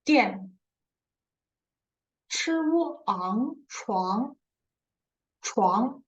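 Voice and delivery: teacher modelling each syllable; slow model reading. Shown as a demonstration. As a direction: sound out each syllable slowly. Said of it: "we read after the new character".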